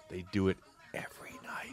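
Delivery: hushed whisper, sharing a secret. Retold or imitated. A local friend whispering about the karaoke bar, hushed. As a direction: whisper it like a tip-off. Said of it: "he goes".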